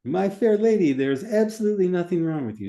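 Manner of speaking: high, loud, hammed-up British accent. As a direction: play it up as a theatrical Brit. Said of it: "Say it in British".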